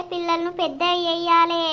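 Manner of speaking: ordinary talk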